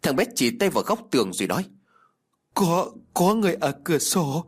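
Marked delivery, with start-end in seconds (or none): 2.5-4.4 put-on scared child. A narrator's reading. Frightened child sees figure.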